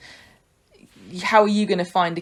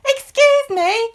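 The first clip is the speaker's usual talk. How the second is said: put-on much higher, slow, pleading little boy; drawn-out child voice; 5 dB louder, much slower than usual. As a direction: stretch "Excuse me" like a whining kid. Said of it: "And Sam goes".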